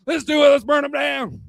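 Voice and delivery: shouted battle cry; rallying shout. Real, not acted. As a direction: whip the crowd up.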